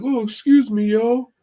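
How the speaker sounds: squeaky goofball ordering food; Mahoney impression. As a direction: ham up your buddy ordering, way up high. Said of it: "It's like".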